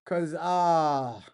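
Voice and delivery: normal talking voice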